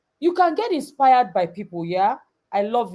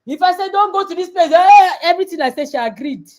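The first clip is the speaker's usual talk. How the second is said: higher, louder, fast scolding; scolding mother; 6 semitones higher, 6 dB louder, faster than usual. Retold or imitated. Mother listing rules to daughter, fast scolding. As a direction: rattle off the rules. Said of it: "I said".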